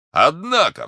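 high, loud cry of protest; indignant exclamation. A narrator's reading. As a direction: burst out in loud objection. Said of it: "вскричал Портос". (громкий возглас протеста)

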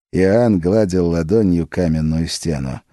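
usual speaking voice

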